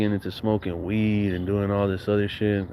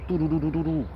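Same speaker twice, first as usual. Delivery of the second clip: skate sound; 6 semitones higher, 4 dB quieter, faster than usual. Retold or imitated. Imitating skating over window ledge.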